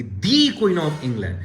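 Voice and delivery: "The" said as a slow stressed "dee"; emphatic the. Shown as a demonstration. Teacher sums up emphatic the Queen of England. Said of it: "জোর দিয়ে কোনো কিছু বলা".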